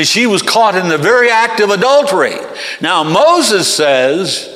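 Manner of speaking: loud, high public accusation; accusing. Acted. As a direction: denounce her, then demand an answer. Accusers confront Jesus over adulteress.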